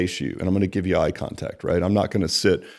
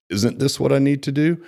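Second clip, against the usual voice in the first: higher pitch, lifting to "do" as a question; asking inflection; 6 semitones higher than usual. Acted. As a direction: say "need to do" as if seeking approval. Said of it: "voice inflection would lead you to believe that they're asking".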